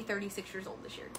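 fast, hushed whisper. Real, not acted. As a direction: whisper it like a secret, quickly. Repeats her age in a whisper.

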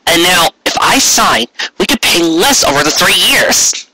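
Carrot's voice. A narrator's reading. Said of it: "continued Carrot".

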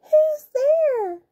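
tiny, high granny voice; high, quavering little old granny; small shaky voice. A narrator's reading. Granny calls to whoever is knocking, small shaky voice. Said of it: "in a small, shaky voice".

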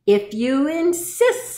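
storybook beetle taking the dare; stag beetle. A narrator's reading. Stag beetle answers the challenge.

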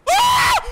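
very high, loud shriek; terrified scream. Real, not acted. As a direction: scream as the lights die. Lights suddenly go out.

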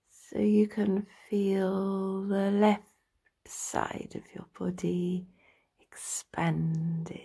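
slow meditation voice with drawn-out words and long pauses; guided relaxation. Acted. Practitioner talking a listener into lying down and stretching, slow guided meditation. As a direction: guide them through the body slowly, stretching each phrase.

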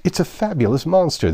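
high-pitched excited outcry; cried out. A narrator's reading. The Unicorn cuts in before Alice replies, crying out. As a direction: cry it out before anyone else answers. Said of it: "the unicorn cried out".

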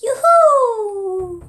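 high, ringing yoo-hoo call; hollering in a forest. Acted. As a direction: shout it like calling through trees. Child calling out toy names.

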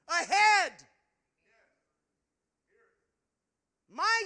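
loud, high, slowed preacher cadence; loud, high preaching, slowing on key words; preaching at full force. Acted. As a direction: preach it to the rafters. Preaching climax.

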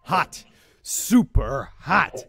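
loud victorious shout; triumphant cheer. Real, not acted. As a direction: cheer the win loudly. Game win cheering.

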